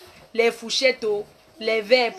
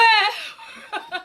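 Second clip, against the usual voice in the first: high giggle; a quick high laugh; 9 semitones higher than usual. Real, not acted. Reacting to a nosy viewer, quick high laugh.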